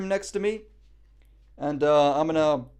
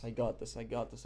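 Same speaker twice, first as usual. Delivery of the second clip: softer, muttered to himself; quiet self-affirmation; 5 semitones lower, 11 dB quieter than usual. Real, not acted. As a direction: say it under your breath to yourself. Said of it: "I gotta speak it into existence".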